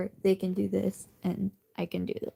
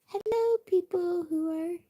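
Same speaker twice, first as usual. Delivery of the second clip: cheery greeting; 8 semitones higher than usual. Real, not acted. Greeting viewers in high voice.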